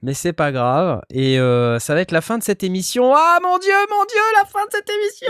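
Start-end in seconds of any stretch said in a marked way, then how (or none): 3.2-5.3 high, alarmed cry; mock alarm. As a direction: gasp at the bad news.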